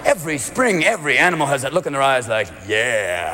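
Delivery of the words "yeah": very low, drawn-out "Yeah"; deep lusty yeah. Pretend. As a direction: drop deep and linger. Animals' spring look voiced.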